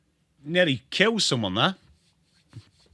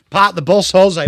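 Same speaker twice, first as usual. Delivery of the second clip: mock call; 2 semitones higher, 9 dB louder than usual. Mock chant of a football nickname.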